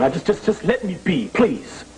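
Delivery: exasperated plea. Acted. Injured player begs to be left alone.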